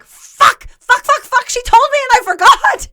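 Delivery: high, loud string of curses; frantic self-reproach, acted out; panicked realisation. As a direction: blurt it in mock panic. Acts out her panicked realization.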